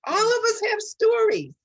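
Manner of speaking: rising, passionate plea; impassioned. Real, not acted. Earnest plea about love and truth.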